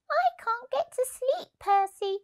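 a tiny cold squirrel pleading. Acted. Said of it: "said the squirrel".

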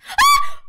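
piercingly high, loud shriek; short scream of sudden fright; real jump-scare shriek; startled scream. Real, not acted.

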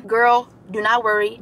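usual speaking voice

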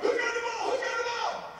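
high frantic shouting. Retold or imitated. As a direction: yell it at the field, desperate to know. Coach shouting on the sideline.

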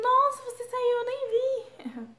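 high, loud surprised mimicry of the friends; mock surprised friends. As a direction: play the friends gasping in surprise. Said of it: "todo mundo". (imitando os amigos surpresos, agudo e alto)

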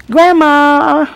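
high, loud call across the room; child calling grandma to wake up. Retold or imitated. Little girl calling her sleeping grandma, loud and high.

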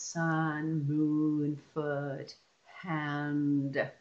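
"sun, moon, foot, hand" each drawn out slowly; slow word-by-word sounding-out; stretched sounds. Shown as a demonstration. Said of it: "continuous sounds".

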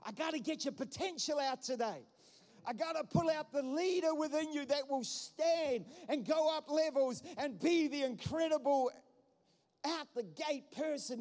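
high-pitched, driving preacher's plea; impassioned preaching. Real, not acted. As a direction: preach it with rising force. Preaches at full force.

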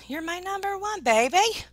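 high, excited endearment; high-pitched loving shout-back; gushing affection. Real, not acted.

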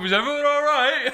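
high called-out shout. Retold or imitated. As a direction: call up to the balconies. Guy shouting up after firework.

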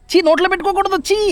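disgusted scolding. Real, not acted. Scolding child for mouthing stone.

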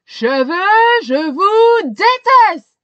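loud, high, slow furious yell; shouting. A narrator's reading. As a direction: drag out the hatred at full volume. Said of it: "crie le monsieur".

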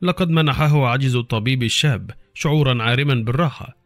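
plain reading voice

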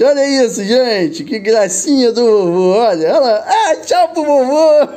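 tender grandpa cooing. Real, not acted. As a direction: coo goodbye to the granddaughter.

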